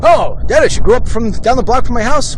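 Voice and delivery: high, eager welcoming voice. Retold or imitated. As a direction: invite him in, bright and high. [name] voiced inviting.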